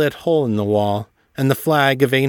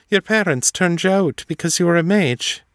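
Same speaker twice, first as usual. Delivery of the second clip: gentle Esme; 7 semitones higher than usual. A narrator's reading. Esme's voice.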